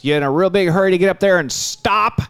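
loud, high imitated yell; yelling dad. Dad yelling at passing driver, imitated holler. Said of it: "roll his window down and yell".

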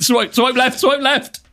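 high, urgent repeated plea; hammed-up panicked squeal; mock panic. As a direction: frantically reject it in pretend horror. Acted recoil at dating profile.

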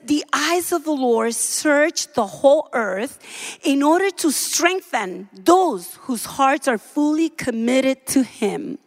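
usual speaking voice

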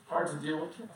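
usual speaking voice

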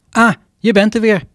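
high, bright-toned navigation voice greeting; app lady voice. Acted. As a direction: chirp it like a sat-nav welcoming you back. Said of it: "zegt de A N W B mevrouw".

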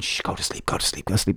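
put-on hushed shushing to a baby; soft whisper, lulling a newborn; whispering over a crib. Walking daughter in pram.

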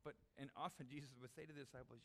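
usual speaking voice

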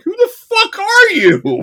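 high, loud, incredulous yelp; hammed-up outraged captain; mock baffled Kirk. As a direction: squeal the question in disbelief. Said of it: "And Kirk's like".

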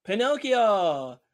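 slow, drawn-out, delighted exclamation; excited. Real, not acted. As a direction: stretch the name out with glee. Excited reply to Pinocchio mention.

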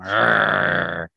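mock snarling growl. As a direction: growl it like a vicious beast. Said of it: "he snarls at her like a vicious animal".

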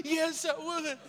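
high, soft mock blubber; crying answer. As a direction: admit it in a tearful whine. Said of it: "'cause I'm crying".

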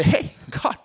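high, half-whispered quick prayer; lightly breathy hushed ask. Retold or imitated. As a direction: whisper a casual request to God. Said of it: "I just say".

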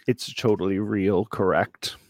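normal talking voice

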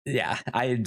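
ordinary talk

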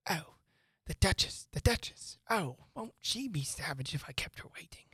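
anxious whispered fretting; a fussy rabbit muttering in a panic; hushed frantic whisper to himself. Acted. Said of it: "muttering to himself as he came".

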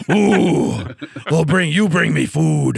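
slow, slurred monster demanding food; drunk Cookie Monster. Retold or imitated. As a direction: drag it out like a tipsy monster. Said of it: "I sound like a drunk Cookie Monster".

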